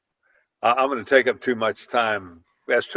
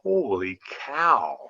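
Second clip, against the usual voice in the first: slow amazed; 3 dB quieter, much slower than usual. Retold or imitated. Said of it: "And I was like".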